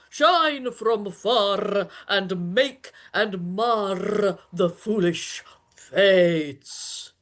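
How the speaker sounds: slow, grand tyrant's rant; lofty ranting. Acted. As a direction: declaim like a raging Hercules. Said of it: "This was lofty".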